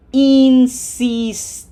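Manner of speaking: Insistir slow and loud, three long "ee" sounds; smiled long I's. Shown as a demonstration. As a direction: smile through each syllable of Insistir. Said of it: "How many times do you have to smile".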